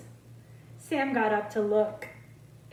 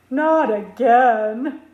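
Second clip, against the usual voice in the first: slow, much louder exasperated groan; mock groan; 11 dB louder, slower than usual. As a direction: groan like a weary pupil. Class groaning at Frizzle's idea.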